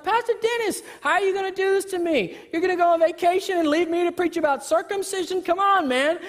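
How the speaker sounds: high, fast whiny protest; teasing Pastor Dennis for leaving on vacation; mock complaint. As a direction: playfully whine at your colleague. Preacher complaining to colleague.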